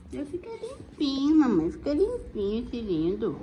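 high squeaky pet voice; voicing the dog. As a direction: speak for the dog like a toddler.

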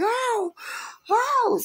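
high drawn-out oh; pained moan. Retold or imitated. Retelling lying on ground, high pained cries. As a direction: wail from the ground.

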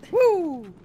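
high, loud whoop; cheer. Real, not acted. Gamer cheers a lucky move in the game. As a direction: let out a victory whoop.

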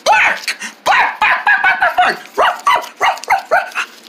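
high playful yapping barks; squeaky pretend barks with a laugh; pretend dog barking. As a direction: bark like a happy puppy. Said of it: "Say bark".

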